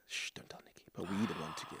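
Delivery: hushed, breathy secret whisper. Real, not acted. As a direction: whisper it so Nikki won't hear.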